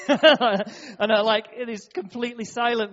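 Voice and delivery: can't stop laughing while telling it. Real, not acted. Laughing while retelling awkward moment.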